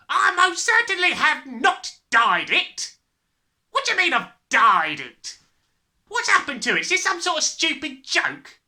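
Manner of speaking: loud, high, indignant denial; yelling. A narrator's reading. Father accused of dyeing his hair, yelling. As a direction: yell your denial. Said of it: "the father yelled".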